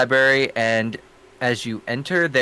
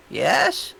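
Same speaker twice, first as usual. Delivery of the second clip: put-on old lady voice; 2 semitones higher than usual. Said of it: "Great old lady voice for one".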